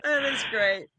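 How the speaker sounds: laughing, winding down. Real, not acted. Still laughing at the story.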